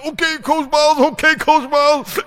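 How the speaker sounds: put-on very high, loud kid's obedient reply; whimpering kid. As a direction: squeak it out like a scared kid. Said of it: "He said".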